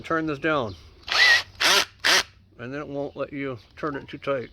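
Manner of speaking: talking around screws held in the lips; screws in mouth. Real, not acted. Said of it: "So I'm gonna talk a little funny for a little bit here".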